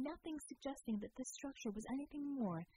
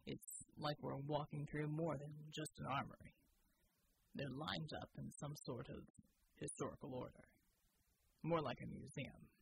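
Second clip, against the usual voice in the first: Bulwark's deep voice; 7 semitones lower, 3 dB quieter than usual. Acted. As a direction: state it flatly, deep and unhurried. Bull muses about armory.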